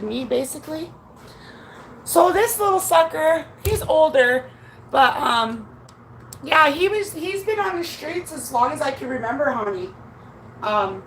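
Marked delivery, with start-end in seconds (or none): none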